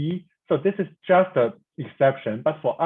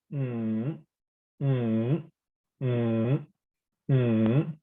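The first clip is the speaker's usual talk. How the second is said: "mm" dipping low, then curving up; deep tone; 2 semitones lower, much slower than usual. Shown as a demonstration. Teacher showing learners the third, deep tone. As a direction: hum "mm" down and back up. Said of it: "So third one would be".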